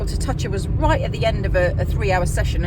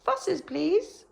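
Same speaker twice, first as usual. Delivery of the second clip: high, eager little dog voice; dog's voice; 12 semitones higher, much slower than usual. Retold or imitated. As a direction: voice the dog asking for cuddles. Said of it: "was like".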